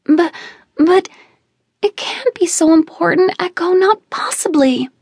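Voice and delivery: put-on high, anxious childish protest; young cousin protesting in fear; scared little girl. A narrator's reading. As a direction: plead like a small frightened girl.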